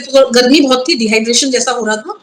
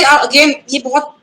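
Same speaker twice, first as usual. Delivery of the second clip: ordinary delivery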